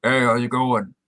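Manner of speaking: low, gruff imitation of Max; deep voice. Friend retelling a TV scene, imitating Max's deep voice. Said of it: "Max hit a deep voice and just goes".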